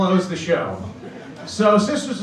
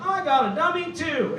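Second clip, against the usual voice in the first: high squeaky puppet; dummy voice; 10 semitones higher, 4 dB quieter than usual. Retold or imitated. Doll delivers punchline to the boy, high squeak. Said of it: "Lester was gonna turn to me and be like".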